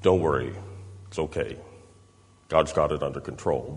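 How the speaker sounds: quiet, low comforting paraphrase; soothing reassurance. Retold or imitated. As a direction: settle a worried friend gently. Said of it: "here's John's paraphrase of Paul's response".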